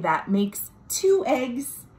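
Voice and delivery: ordinary delivery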